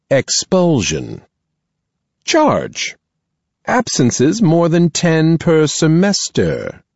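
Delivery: a headmistress weighing her words; stern principal voice. Acted. Principal reading out charges.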